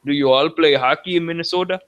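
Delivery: mock Minnesota accent. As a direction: play up the Minnesota accent as a joke. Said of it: "do you really want me to talk like that".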